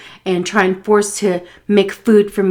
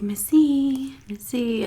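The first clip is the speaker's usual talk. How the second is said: gentle whisper; 7 semitones higher, much slower than usual. Retold or imitated. Waking sleeping woman gently.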